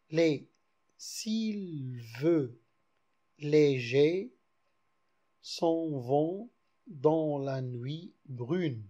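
slow, measured verse reading; poem recital. Shown as a demonstration. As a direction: let the line drift slowly like the night. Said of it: "voilà un vers".

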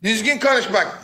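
ordinary delivery

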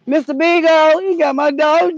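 frantic call for the missing dog. Real, not acted. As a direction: shout for the lost dog. Calling for lost dog.

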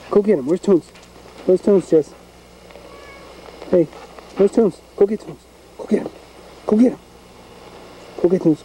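high, urgent pet-owner urging; egging on dog. Real, not acted. Owner sends his dog after the cat, high urging. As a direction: send the dog off in short, high calls.

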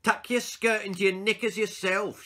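high and loud rude yell; girl yells at the games teacher; defiant shout. Acted. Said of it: "she shouted at her games teacher".

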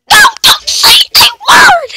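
very high-pitched, squealed order; mock outraged squeal. Acted scolding of a character.

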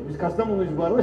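normal talking voice

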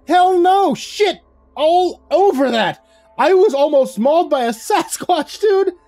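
panicked, outraged screeching tirade; screeching rant. A narrator's reading. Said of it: "I flailed my arms and screeched".

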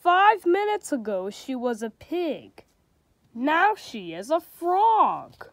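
storybook reading with light character voices; reading to children. A narrator's reading. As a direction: read it like a bedtime picture book. Reads a picture book aloud.

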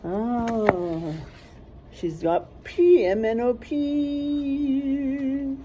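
usual speaking voice

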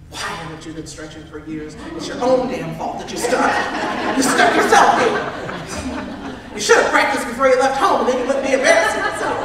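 mock self-scolding. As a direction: berate yourself for laughs, rising in pitch and volume. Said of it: "got this conversation going on in my head".

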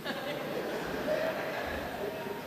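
a quiet airy chuckle at his own joke; amused exhale after the punchline; soft breathy laugh. Real, not acted. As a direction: let a soft laugh slip out.

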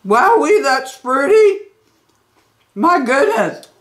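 words through cereal mouthful; muffled chewy speech; chewing while talking. Real, not acted. Seen 1: Bite at -9; holds bowl, jaw working while speaking.

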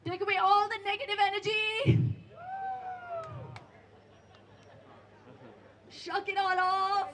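high, loud rallying cheers; pumped-up hype. Real, not acted. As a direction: fire up the crowd at full volume. Instructor hypes the crowd to finish.

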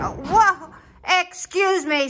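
play-acted alarm, pitch jumping up; startled. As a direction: react as if a passenger just did something alarming. Startled by a pretend passenger.

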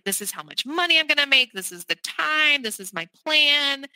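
lightly voiced careful pitch; pitching to a parent. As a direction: sell the plan like a nervous kid to a parent. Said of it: "like I was approaching my father".

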